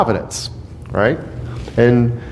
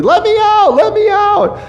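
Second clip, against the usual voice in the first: high, piercing mock wail from the pit; mock wailing Joseph. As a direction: wail it like a trapped boy, hammed up. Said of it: "he's probably wailing, he's like".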